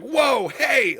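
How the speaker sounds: high-pitched, loud imitation of a startled character; startled exclamation. Reactor quoting a favorite voice performance, startled high shout. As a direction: yelp it out, mimicking her alarm. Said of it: "and she's like".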